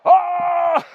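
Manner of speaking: high, loud delighted shout into a laugh. Retold or imitated. Chief roaring with laughter at the foreigner's taunt. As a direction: burst out laughing.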